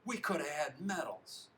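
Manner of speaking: high, loud bitter complaint; angry complaint. A narrator's reading. Teammate blames the loser for lost medals, angry. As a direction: protest it with a shaken fist's force. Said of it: "One Purple shakes his fist".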